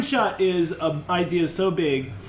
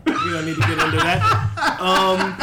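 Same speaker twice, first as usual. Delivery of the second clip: laughing; 11 semitones higher, 5 dB louder than usual. Real, not acted. Laughs at own remark.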